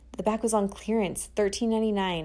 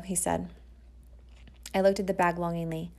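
ordinary delivery

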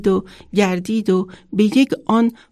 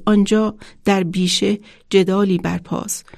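ordinary delivery

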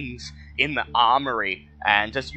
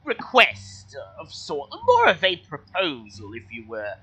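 kobold voice; 5 semitones higher than usual. Acted. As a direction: speak as a polite high-pitched kobold.

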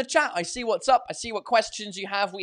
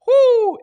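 loud high yelp at hot mug; sharp whoo; 13 dB louder than usual. Real, not acted. Host yelps loudly as the hot metal mug burns his hand.

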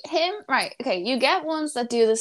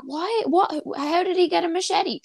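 baffled; 6 semitones higher, 3 dB louder than usual. Retold or imitated. Baffled detectives quoted.

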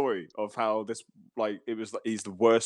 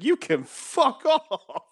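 high, slow, laughing fuck off; laughing retort; 12 semitones higher, 3 dB louder, much slower than usual. Retold or imitated. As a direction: drag it out through a laugh. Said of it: "I was like".

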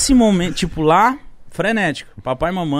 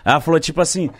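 usual speaking voice

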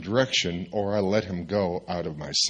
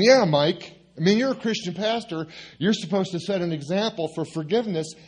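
higher mock chiding voice; ganging-up friends; 9 semitones higher than usual. Three friends scolding the pastor, higher mock chiding. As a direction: chide him like a chorus of friends, pitched up.

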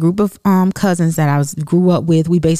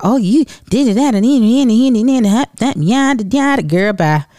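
higher, sassy mock of her taunts; mocking the cousin; 6 semitones higher than usual. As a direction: mimic her smack talk, dismissive. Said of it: "she said, was basically talking smack".

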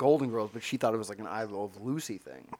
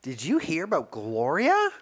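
put-on gossipy old lady; 5 semitones higher, 6 dB louder than usual. Playing a mall-walker lady.

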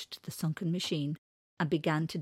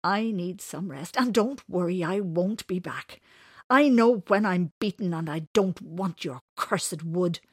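bitter; 2 semitones higher, 9 dB louder than usual. Acted. Winters gives up on the wood, bitter. Beaten man leaves bitterly.

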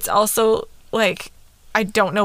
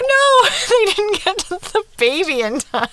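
high, laughing cry of dismay; 11 semitones higher, 3 dB louder than usual. Retold or imitated. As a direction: wail it through laughter. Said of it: "And then I'm like".

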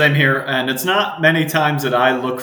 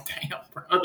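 whispered; 5 semitones higher, 9 dB quieter than usual. Retold or imitated. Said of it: "So I was like".

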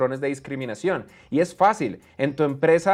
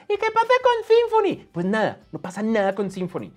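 mock question; 6 semitones higher than usual. Mock dialogue about Symfony.

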